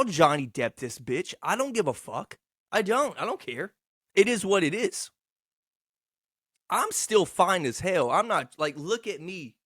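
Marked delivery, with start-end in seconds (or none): none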